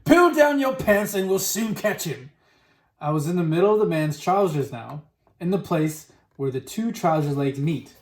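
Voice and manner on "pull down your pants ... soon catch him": high, raised character voice; shouted. A narrator's reading. Onlooker tells the cook how to catch it, shouted. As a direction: call out the plan in a raised, high voice. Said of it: "someone else shouted".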